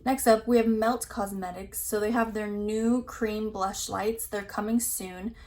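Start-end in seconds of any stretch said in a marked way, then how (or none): none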